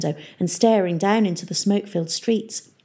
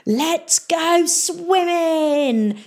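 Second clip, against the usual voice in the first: high, slow, hammy call to go swimming; pirate mayor's shout; 11 semitones higher, much slower than usual. Acted. Pirate mayor calling to his crew, gleeful shout. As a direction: bellow it out like a gleeful pirate boss. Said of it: "shouted the pirate mayor".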